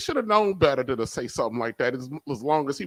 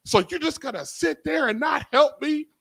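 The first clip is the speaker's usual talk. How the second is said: imitating a frustrated grandfather, much higher; exasperated grandpa; 12 semitones higher than usual. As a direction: play the flustered old man who burned breakfast. Grandpa complains, voiced in story.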